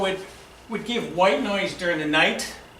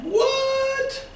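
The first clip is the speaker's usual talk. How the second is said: high incredulous what; surprised. Retold or imitated. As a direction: squeak out disbelief. Stunned reaction.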